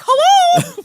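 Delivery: very high, loud exaggerated hello; mock Jersey hello. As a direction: greet the whole town over the top. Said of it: "everyone was like".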